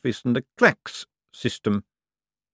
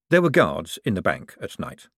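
plain reading voice

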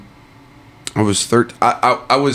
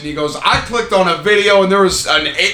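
much higher and slow mock stepdad voice; a lightly voiced stepdad, disbelieving; retelling the stepdad's shocked questions; incredulous stepdad; 9 semitones higher than usual.

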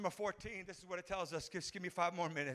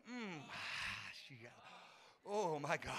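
normal talking voice

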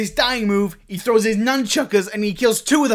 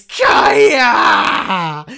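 crying higher shout of Kaya; sad scream; 4 semitones higher, 7 dB louder than usual. Acted. Screaming out the dying Kaya's name, crying. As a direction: wail her name in grief. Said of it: "You scream out her name, all sad".

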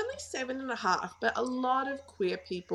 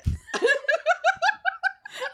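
high burst of laughter; 4 dB louder than usual. Real, not acted. Laughs at son's blunt answer.

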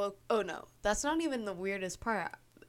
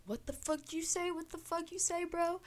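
much higher, mock-tough challenge; 10 semitones higher, 4 dB quieter than usual.

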